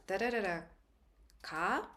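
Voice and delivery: da da da da ka with ka rising at the end; rising intonation demo. Shown as a demonstration. Teacher demonstrates the question tune on ka. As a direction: hum the tune, lift the final ka. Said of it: "a rising intonation, like".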